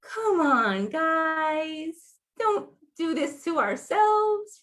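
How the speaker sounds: light imploring come-on-guys; pleading with her own. Retold or imitated. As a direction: appeal to the community, exasperated. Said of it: "I feel like".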